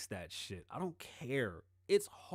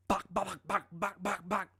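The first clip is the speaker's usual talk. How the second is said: imitated gunfire; 6 semitones higher, 4 dB louder than usual. Retold shootout.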